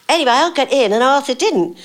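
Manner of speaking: ordinary delivery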